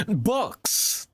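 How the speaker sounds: "Books!" rising up, excited and sure; sudden realization. Real, not acted. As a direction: pounce on the answer like you just got it. Realizing the riddle answer.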